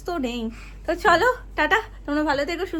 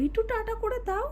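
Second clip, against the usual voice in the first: high, soft, squeaky voice for একটু tata করে দাও; squeaky silly voice; 6 semitones higher, 7 dB quieter than usual. Pretend. Mother coaxing toddler to wave bye, high, soft, squeaky voice.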